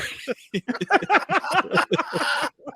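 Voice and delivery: high-pitched burst of laughter. Real, not acted. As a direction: squeal with laughter. Breaks up laughing.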